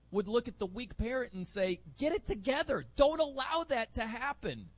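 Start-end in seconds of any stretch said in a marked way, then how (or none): 2.0-4.7 high-pitched, insistent rebuke; firm scolding. Acted. Scolding a weak parent who allows it, firm rebuke. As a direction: tell them off, pitch pushed up.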